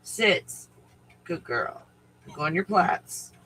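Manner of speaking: ordinary talk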